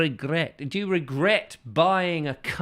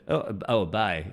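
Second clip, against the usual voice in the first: ordinary talk, nothing marked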